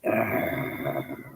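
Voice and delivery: low, soft groan of annoyance; mock groan. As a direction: groan as if fed up. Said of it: "I'd be like".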